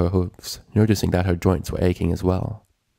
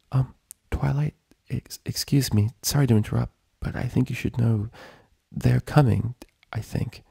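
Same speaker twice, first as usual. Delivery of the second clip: soft, high, hesitant voice; timid whisper; 5 semitones higher, 3 dB quieter than usual. A narrator's reading. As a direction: barely dare to speak up. Shy Fluttershy whispering warning.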